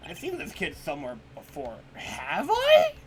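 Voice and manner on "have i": surprised high. Real, not acted. Denies knowing the kid.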